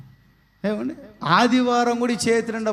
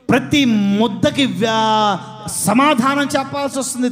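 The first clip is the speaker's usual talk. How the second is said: shout; 2 semitones higher, 4 dB louder than usual. Acted. Preacher warning of judgment.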